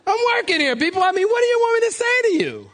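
high-pitched, exasperated protest. Acted. Exasperated reply to critics, high strained pitch.

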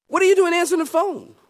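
high, fast and surprised; surprised lady caller. Retold or imitated. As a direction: play the astonished lady caller.

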